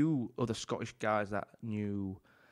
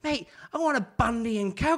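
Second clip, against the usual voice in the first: high, loud, exasperated mock-Aussie; Aussie accent; 12 semitones higher, 5 dB louder than usual. Aussie customer demanding his drink from barman, put-on Aussie accent. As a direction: imitate an insistent Aussie drinker. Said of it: "Aussie accent".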